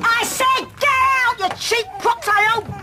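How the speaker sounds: furious order. Acted. Woman ordering her rival out, furious order.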